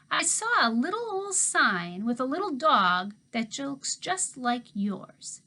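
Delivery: put-on sly old handyman. A narrator's reading. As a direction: let it dangle meaningfully.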